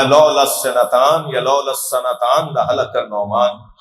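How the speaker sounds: slowly intoned saying; deliberate, measured recitation of the saying; slow quoted Arabic; slow Arabic quote. Retold or imitated. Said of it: "फरमाया".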